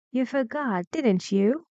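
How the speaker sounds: "didn't you" lifting at the end; high "You forgot", then "didn't you" going up; rising tag question. Shown as a demonstration. Teacher reads a real question, rising "didn't you". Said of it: "Yes, rising".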